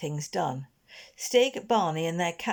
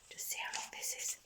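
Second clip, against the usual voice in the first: whispered aside; 14 dB quieter, faster than usual. Real, not acted. Reader's aside.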